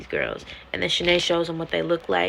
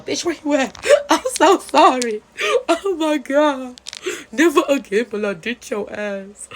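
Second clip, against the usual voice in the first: loud and high, laughing sorry; frantic apology; 7 semitones higher, 10 dB louder than usual. Retold or imitated. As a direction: beg forgiveness over the phone. Frantic friend apologizing to voicemail.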